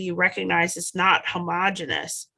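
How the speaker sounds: normal talking voice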